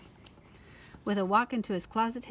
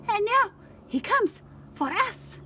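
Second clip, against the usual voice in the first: high slow ominous voice; 12 semitones higher than usual. A narrator's reading. Poquito dramatic slow.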